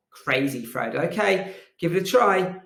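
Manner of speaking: ordinary talk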